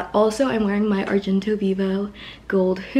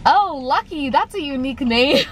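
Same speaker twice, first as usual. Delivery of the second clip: higher, bright mock cashier voice; 7 semitones higher than usual. Retelling the cashier who renamed her Lucky. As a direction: imitate him mishearing her name. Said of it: "he was like".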